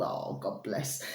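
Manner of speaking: soft breathy whisper; whispered aside. Real, not acted. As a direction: whisper it tenderly. Tender aside about his daughters.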